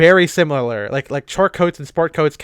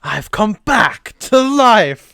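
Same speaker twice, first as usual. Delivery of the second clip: slow, high, lisping monster voice; Boris Karloff voice; 4 semitones higher, 5 dB louder, slower than usual. Retold or imitated. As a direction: drag it out like an old horror mummy. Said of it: "he's doing the Boris Karloff voice".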